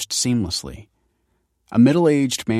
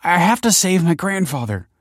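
high, louder desperate plea; raised, urgent appeal; imploring; 10 semitones higher, 5 dB louder than usual. A narrator's reading. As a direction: implore him for help. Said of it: "implored Michael".

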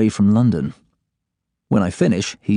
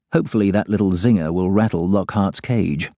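ordinary delivery, nothing marked

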